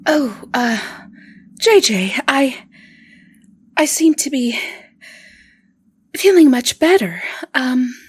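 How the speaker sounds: dazed, rising voice of the revived woman. Acted. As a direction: come back to life, a little giddy. Margarita revived, speaking in character.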